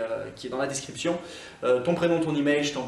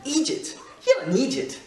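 Scottish accent; 11 semitones higher than usual. Shown as a demonstration. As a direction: hurl it like an exasperated Scot. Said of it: "quand je le dis". (accent écossais, voix haute)

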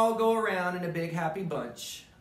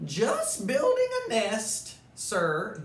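higher, louder bird voice, brushing him off; cheeky bird; 5 semitones higher, 3 dB louder, slower than usual. Acted. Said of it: "the Zinazoo said".

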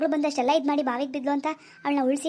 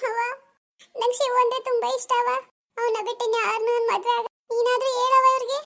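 put-on higher young daughter's voice, begging her mother; pleading daughter; 7 semitones higher than usual. Daughter begs her mother, a higher pleading girl's voice. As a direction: beg mother to let her marry Shivu.

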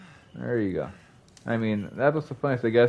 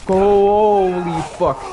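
slow, loud holy fuck; loud, stretched panic; drawn-out exclamation; 6 semitones higher, 7 dB louder, much slower than usual. Real, not acted. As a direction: drag out holy in alarm. Panic in a fight.

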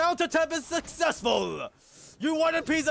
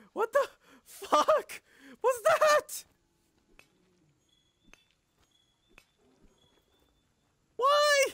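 shocked disbelief; 9 semitones higher, much slower than usual. Real, not acted. Shocked by monster.

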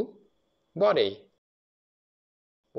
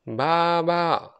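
lamb bleat; 4 semitones higher, 4 dB louder than usual. Pretend. Lamb sound for children.

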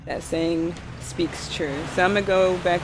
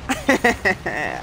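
ordinary talk, nothing marked

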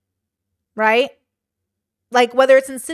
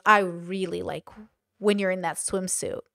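low, slow, softer creepy voice; creepy stranger; 5 semitones lower, 5 dB quieter than usual. Shown as a demonstration. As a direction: lean in slowly like an unsettling stranger. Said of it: "don't say that to my child".

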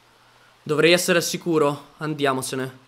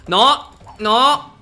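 desperate panic; 7 semitones higher than usual. Real, not acted. Gamer chased by a horde, high desperate no's. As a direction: cry out in desperation.

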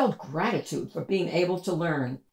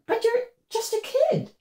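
put-on higher girlish voice, curious; young girl voice. A narrator's reading. Sophie asking the trash-carrying girl, high girlish voice. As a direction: ask like a puzzled little girl.

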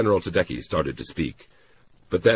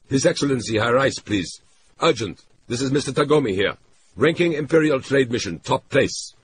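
firm and commanding, a little louder; hard, no-nonsense; 8 semitones higher, 4 dB louder than usual. A narrator's reading. Tagomi demands the consul from the clerk, no-nonsense. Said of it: "He used his hard, no-nonsense voice".

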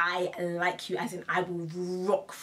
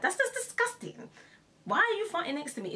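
pitched way up in disgust; disgusted rant; 12 semitones higher than usual. Real, not acted. Telling listeners how farting near her disgusts her, high disgusted squeal. Ranting about people farting nearby.

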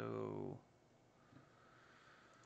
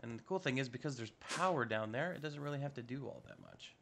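ordinary talk, nothing marked

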